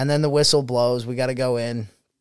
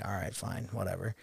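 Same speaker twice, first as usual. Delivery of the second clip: grudging sigh; 5 semitones lower, 15 dB quieter than usual. Retold or imitated. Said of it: "I'm like".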